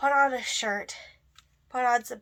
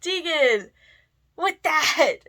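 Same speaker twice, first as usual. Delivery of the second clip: loud, drawn-out high whoa; big mock astonishment, raised pitch; sister's amazed reaction; 6 semitones higher, 11 dB louder, much slower than usual. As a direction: imitate a sister exclaiming at wild makeup. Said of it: "she'll go".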